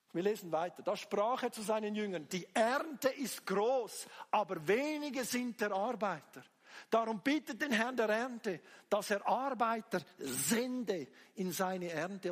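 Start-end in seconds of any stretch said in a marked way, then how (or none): 2.3-12.3 loud, drawn-out Bible verse; declaimed scripture. Real, not acted. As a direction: proclaim the verse with slow emphasis. (feierlich laut verkündet)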